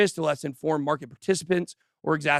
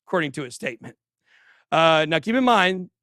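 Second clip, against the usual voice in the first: ordinary delivery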